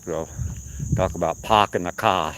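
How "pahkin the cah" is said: put-on Boston accent with the r's dropped. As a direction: say it like a Bostonian, "pahk". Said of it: "Anytime you hear someone from Boston".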